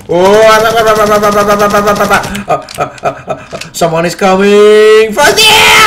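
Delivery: loud run of ah-ah-ah into a yell; panicked stammering. Real, not acted. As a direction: stammer in panic, then draw out a rising scream. Panics as someone approaches.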